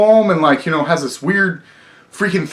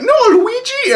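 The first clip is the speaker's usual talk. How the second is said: much higher, squeaky princess voice; a mock Peach cry, pitched way up; imitating Peach. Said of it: "Peach is like".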